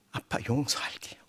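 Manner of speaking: near-whispered, low retold line; hushed forgiveness. Preacher voices daughter embracing her father, hushed forgiveness. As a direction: barely voice the forgiveness.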